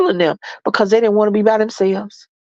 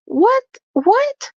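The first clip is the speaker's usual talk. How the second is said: incredulous; 14 semitones higher than usual. Real, not acted. Disbelief at staying together.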